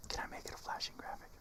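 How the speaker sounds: muttering under breath; whispering. Real, not acted. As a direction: whisper to yourself.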